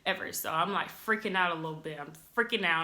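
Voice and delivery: normal talking voice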